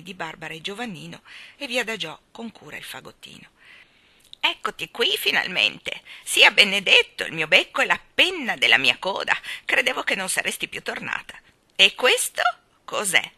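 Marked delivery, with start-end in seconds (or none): 4.4-13.3 loud, excited starling voice with swooping pitch; a loud squawking welcome; squawky bird cry. A narrator's reading. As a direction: cry out like a clucking, chattering bird. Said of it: "gridò una voce chioccia".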